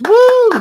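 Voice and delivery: high, loud "woo"; celebratory whoop. Real, not acted. Host cheering a viewer's thirty-seven years, loud high whoop.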